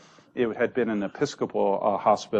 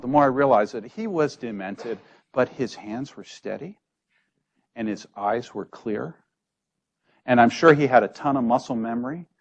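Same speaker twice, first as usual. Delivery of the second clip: ordinary talk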